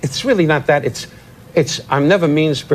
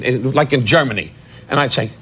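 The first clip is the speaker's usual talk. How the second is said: ordinary talk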